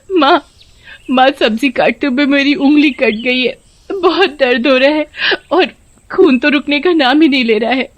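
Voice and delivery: high-pitched crying appeal to mother-in-law; tearful, in pain. Acted. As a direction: whimper about the hurt, pleading for help. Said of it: "रोते हुए माँ के पास जाती है". (दर्द में रोती हुई बहू)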